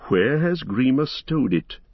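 usual speaking voice

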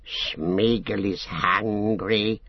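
slow, drawn-out creature voice; a whining creature stretching every word; slow, pleading Gollum croon. A narrator's reading. Gollum speaks.

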